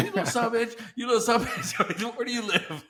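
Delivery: hammed-up fake rage, pitched high and laughing; mock tirade at a kid, pitched high; mock cussing back. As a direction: play the furious gamer for laughs, half laughing. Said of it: "everything in me wanted to just cuss back".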